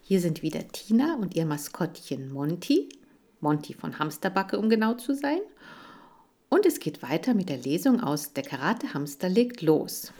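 usual speaking voice

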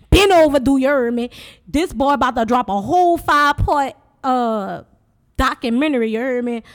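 loud New Orleans yat accent. Shown as a demonstration. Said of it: "I gotta give y'all my New Orleans voice".

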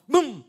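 booming explosion sound; loud mock explosion. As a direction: blow it up with your voice. Imitating explosion, loudest.

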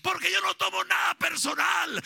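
preacher shouting his love to absent members; full-force preaching. Acted. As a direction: preach it with fire.

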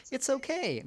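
high, prim and proper; prim reassurance. Retold or imitated. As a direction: reassure primly, voice lifted high. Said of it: "And she's like".

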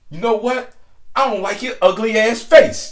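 high, loud mock insult; imitated angry woman. Woman insulting the storyteller's looks, retold high and angry. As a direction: play her telling him off, voice way up. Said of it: "this bitch was mad".

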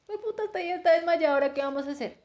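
high, worried mock panic, fading down; a hammed-up fright that calms by the end. Retelling the girl finding her friend fainted, mock panic.

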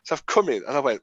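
ordinary talk, nothing marked

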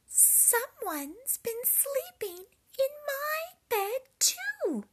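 a lofty indignant mama bear. A narrator's reading. Mama Bear noticing her porridge, high loud indignation. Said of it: "said Mama Bear".

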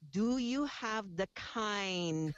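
very slow, spelled-out Pidgin question. Retold or imitated. Samoan customer repeats his question for a confused clerk, very slow. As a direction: drag each word out for the newcomer. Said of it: "slowed it down".

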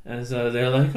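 ordinary delivery

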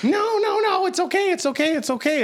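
high-pitched, hurried mock plea; frantic placating. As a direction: imitate a friend rushing in to calm a fight. Friend runs up to stop fight.

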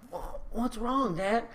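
quiet, slow fake-sleepy kid; badly faked drowsy mumble; mock just-woke-up voice; terrible child acting. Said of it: "terrible child acting".

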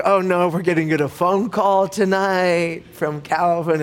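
slow, drawn-out mock dismay; a touch of comic foreboding; acted dread. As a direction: play a parent groaning at coming trouble. Parents dreading a phone call.

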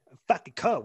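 loud, high mock Boston; mock Boston accent. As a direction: echo it in a hammed-up Boston accent. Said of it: "What the fuck did I just say".

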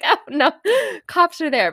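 high, loud, laughing exclamation; real giggle bursting into the words; amused. Real, not acted. Laughing at own silly thought.

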